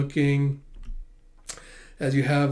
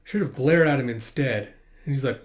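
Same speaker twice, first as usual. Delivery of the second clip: ordinary delivery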